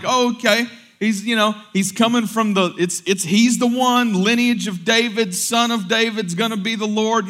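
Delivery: high, loud, animated voicing of believers; excited crowd. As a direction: imitate people eagerly working it out. Voicing what the crowd is thinking.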